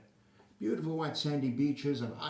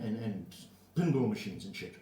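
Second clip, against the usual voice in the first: ordinary delivery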